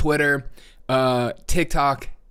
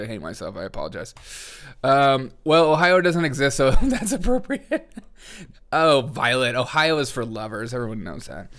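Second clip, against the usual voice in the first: usual speaking voice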